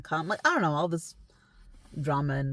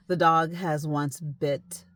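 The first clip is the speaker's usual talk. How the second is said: ordinary talk, nothing marked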